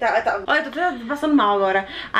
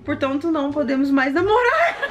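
high, laughing mock reading; mocking quote through real laughter; laughing mockery; 6 semitones higher than usual. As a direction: read her fake breakup while cracking up. Mock-reading friend's breakup text.

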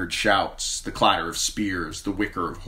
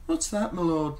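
Shay's voice; 11 semitones higher than usual. A narrator's reading. Sleepy lover asks.

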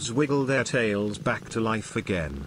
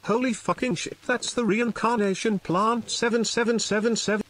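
pitched way up, gushing in amazement; excited exclamation; 10 semitones higher than usual. Acted. Old man marvelling at the magic plant, excited high cry. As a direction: squeal it out like he can't believe his eyes.

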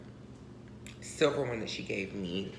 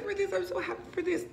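much higher, squealing with joy; excited squeal; faster than usual. Real, not acted. Squealing to viewers over the gift she opened.

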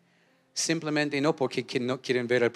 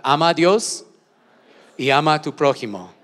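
called out loud; 9 dB louder than usual. Shown as a demonstration. Pastor leading the church in a loud call.

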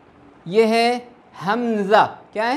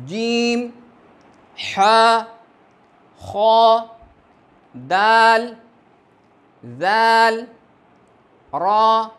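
each letter called out loud and separate, with a pause after; alphabet recitation; 3 semitones higher, 4 dB louder, much slower than usual. Shown as a demonstration. Teacher calling out Arabic letters to students, loud and measured. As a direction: recite the letters clearly for learners to repeat.

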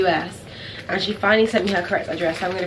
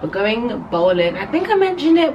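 usual speaking voice

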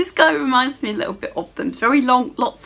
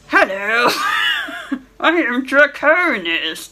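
put-on dragon voice; 3 semitones higher, slower than usual. Dragon introducing himself to the player, in a put-on voice. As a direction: play a dragon announcing himself, slowly.

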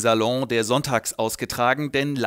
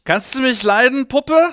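put-on loud, much higher drunken leer; drunken come-on; 9 semitones higher, 7 dB louder than usual. Drunk Heinrich calling the maid Puppe, loud and high. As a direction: slur it out, loud and high, at the girl.

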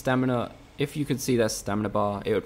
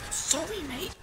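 squeaky apology; 5 dB quieter, slower than usual. Acted. Repeats apology to a game character.

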